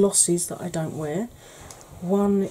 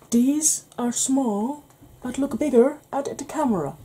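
bad Irish accent; 5 semitones higher than usual. Vlogger adapting Father Ted line for the camera, bad Irish accent. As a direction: parody Father Ted's patient explaining in mock Irish. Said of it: "Worst Irish accent ever".

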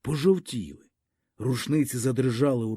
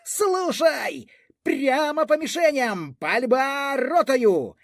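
very high firing command; wild-voiced shout; 10 semitones higher, slower than usual. A narrator's reading. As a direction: bellow the order to fire, pitched far up. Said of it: "диким якимсь голосом закричав". (дикий крик команди)

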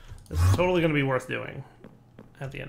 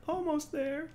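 "Almost there" much higher, rising at the end; high squeaky rise; 13 semitones higher, 6 dB quieter, slower than usual. Shown as a demonstration. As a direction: squeak it up hopefully.

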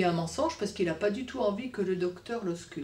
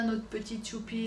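ordinary delivery, nothing marked

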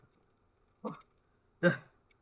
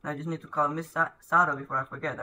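ordinary talk, nothing marked

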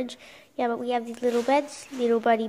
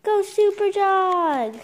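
higher, slow cheer; 7 semitones higher, slower than usual. Acted. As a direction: cheer the hero. Cheering in comic.

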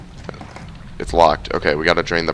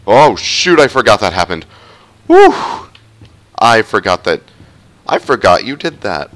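loud, higher startled outburst; 4 semitones higher, 9 dB louder than usual. Real, not acted. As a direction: caught off guard, loud. Startled by game event.

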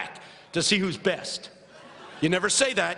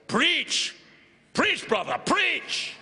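imitated deacon's high call of encouragement; deacons' yell; 7 semitones higher, much slower than usual. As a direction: call it out like a deacon egging on the preacher. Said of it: "the deacons yell".

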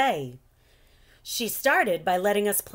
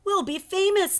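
excited boast; 5 semitones higher than usual. Acted. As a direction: voice soaring high on famous. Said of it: "Carlos said".

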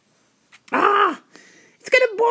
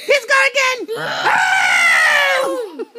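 excited whoop; 4 semitones higher, much slower than usual. Real, not acted. Host cheering the animals going past, whooping. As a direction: cheer like the parade is a party.

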